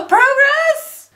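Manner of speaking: delighted squeal. Real, not acted. Seeing latex come off, she squeals with delight. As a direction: celebrate the small win. Thrilled at progress.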